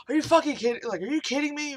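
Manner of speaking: high-pitched, incredulous retold outburst; exasperated but not mad at the listener; exasperated disbelief. Cop learning it was a false call, exasperated disbelief. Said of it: "not in a way that he was mad at us".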